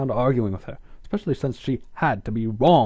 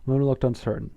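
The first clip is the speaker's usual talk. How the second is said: usual speaking voice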